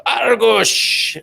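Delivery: high, drawn-out pirate cry. Acted. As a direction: roll out a pirate "arr" on the name. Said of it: "Pirate [name]?".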